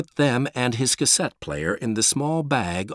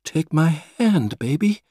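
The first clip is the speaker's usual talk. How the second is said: slower pleading again; 6 semitones higher than usual. Acted. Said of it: "Mom said again".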